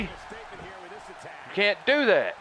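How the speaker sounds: high-pitched, exasperated mock scolding; indignant trash talk. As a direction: tell off the taunting opponent in disbelief. Berates opponent for taunting in game.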